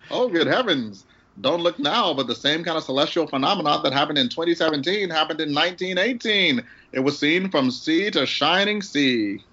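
high, hammed-up vintage announcer; old-time announcer. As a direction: do a creaky attempt at a newsreel announcer. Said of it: "gonna try to do my old time announcer voice".

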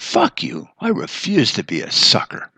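sudden high yelp of "Fuck you"; defiant outburst. Retold or imitated. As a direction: blurt it out like half of you snapped. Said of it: "half of me is like".

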